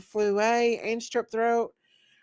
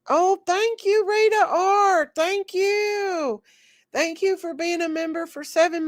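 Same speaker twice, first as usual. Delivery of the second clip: choked up, thanking her through tears; tearful thanks; 7 semitones higher, 3 dB louder than usual. Real, not acted. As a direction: voice cracking high as she fights back real tears. Moved by member's kind message.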